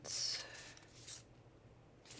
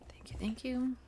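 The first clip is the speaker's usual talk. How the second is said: whispered thanks; 7 semitones higher than usual. Real, not acted. Whispered thanks after chant.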